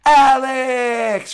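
high, loud shout of a name across the gym; yelling a name. Retold or imitated. The fighter shouts the coach's name across the mat. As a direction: call him out loud from off the mat.

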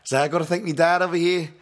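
high, loud put-on British fighter; mock English accent. Host imitating funny fighter outing his dad, loud mock English accent. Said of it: "Like, fucking outing his dad".

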